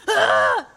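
loud mock lion roar "Ah!". Preacher acting the roaring lion to scare, loud. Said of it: "he goes".

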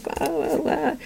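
put-on high, soft baby babble; lightly voiced baby fuss; imitating fussing baby. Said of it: "he's just like".

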